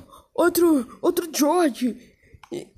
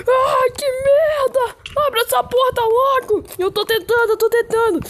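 high, panicked cries to run; screaming for the door to open; terrified horror victim; 5 semitones higher, slower than usual. Acted. Kids trapped, clown attacking.

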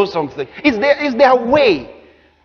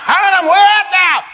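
God calling out; 8 semitones higher than usual. Acted. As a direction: call out for someone hiding. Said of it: "God is pretending he doesn't know".

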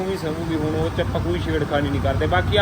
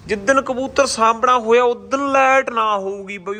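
louder and higher, slow and emphatic; speaking louder; 7 semitones higher, 6 dB louder, slower than usual. Real, not acted. Vlogger complaining to viewers about the power cuts, louder and slower. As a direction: raise the voice to drive the point home, slowly. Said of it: "ਥੋੜ੍ਹਾ ਜਿਹਾ ਜ਼ਿਆਦਾ ਉੱਚੀ ਬੋਲ ਰਿਹਾ ਸੀ".

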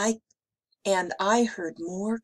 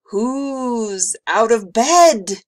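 slow, loud, menacing call; icy monster; 5 semitones higher, 12 dB louder, much slower than usual. A narrator's reading. Tentacled monster hunting for children out of bed, icy menace. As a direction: boom it slowly as a spooky monster. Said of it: "an icy voice called".

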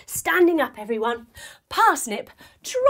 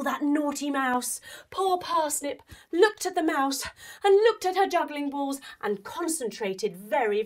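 ordinary delivery

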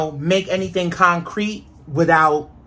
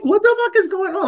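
much higher, disbelieving exclamation; baffled outburst; 13 semitones higher than usual. Retold or imitated. Retelling her confusion about the earthquake, high baffled exclamation. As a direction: throw it up high in total confusion. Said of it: "I'm like".

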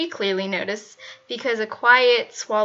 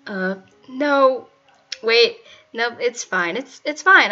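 flustered, louder rush of denial; desperate; 6 dB louder, slower than usual. A narrator's reading. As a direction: blurt it out, too fast to seem chill. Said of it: "came out as a desperate cry".

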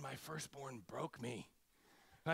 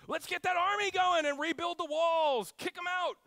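high, loud war cry; rally the troops to retake the walls; rallying shout; 14 semitones higher, 11 dB louder than usual. Retold or imitated. Crowd urging Jesus to raise an army, loud rallying shout. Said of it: "crowd demanding revolt".

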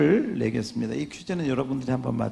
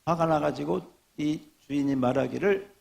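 usual speaking voice

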